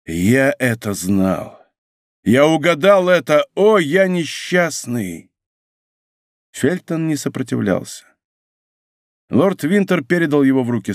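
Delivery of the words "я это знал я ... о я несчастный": plain reading voice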